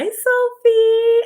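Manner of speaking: high, loud call of Sophie. Real, not acted. Greeting her watching niece, calling out loudly. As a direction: sing out her name across the room.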